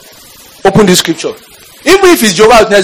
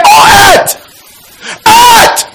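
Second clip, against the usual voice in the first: prophetic shout; much slower than usual. Acted. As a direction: call out to the earth with force. Preacher proclaims the scripture.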